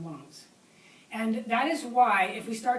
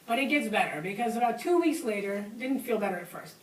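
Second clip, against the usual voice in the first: usual speaking voice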